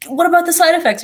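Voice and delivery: high, loud mock-worried parent. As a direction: squeak it out like a fretting parent. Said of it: "your parents are like".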